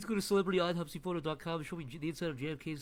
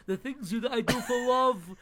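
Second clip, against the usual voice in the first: slow, high cartoon dog; 6 semitones higher, 5 dB louder, slower than usual. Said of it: "as a purple dog once said".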